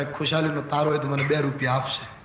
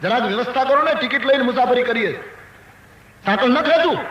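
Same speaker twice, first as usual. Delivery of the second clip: high, forceful telling-off; indignant scolding; 7 semitones higher, 3 dB louder than usual. Retold or imitated. Grandfather rebuking the train official over poor management, indignant. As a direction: act out an outraged rebuke. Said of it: "એકદમ આક્રોશ ભર્યા સ્વરમાં ધમકાવા લાગ્યો".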